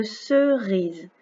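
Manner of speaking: slow, drawn-out "cerise" in two parts; syllable by syllable. Shown as a demonstration. Teacher presenting the next picture word, slowly syllable by syllable. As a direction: stretch "ce", then "rise".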